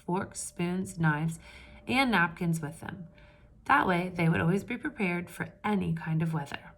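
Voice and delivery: steady read-aloud storytelling voice; story narration. A narrator's reading. Teacher reading a picture book to the class, storytelling voice.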